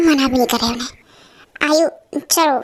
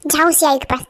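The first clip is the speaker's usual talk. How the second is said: normal talking voice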